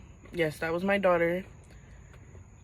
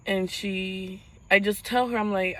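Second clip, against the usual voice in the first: ordinary talk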